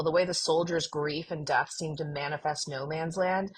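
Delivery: ordinary talk, nothing marked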